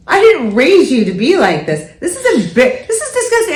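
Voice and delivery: mock scolding mom. Mom retelling how she scolded her daughter, hammed-up outrage. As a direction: scold like an outraged mother, hamming it up.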